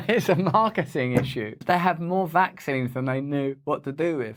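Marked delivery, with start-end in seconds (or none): 0.0-1.5 laughing it off. Real, not acted. Host laughing at the vaccine glut as a marketing issue. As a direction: laugh through the line.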